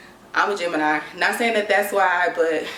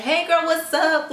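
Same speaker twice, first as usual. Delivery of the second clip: high, drawn-out mock-friendly hello; cheery greeting; 9 semitones higher, slower than usual. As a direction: greet her as if nothing happened, high and slow. Acts cheerful greeting after silent treatment.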